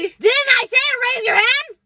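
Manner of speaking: shrill teacher shout. Acted. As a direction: yell it like a furious teacher. Scolding escalates to shout.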